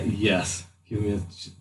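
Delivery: usual speaking voice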